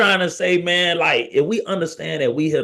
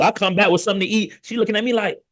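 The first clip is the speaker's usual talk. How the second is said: ordinary talk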